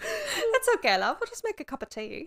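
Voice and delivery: a cosy Brit calming a friend; light British accent, settling down; mock British accent.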